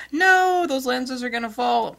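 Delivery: high, loud mock alarm through laughter; mock panic. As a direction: squeal like a worried viewer. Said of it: "Everybody's like".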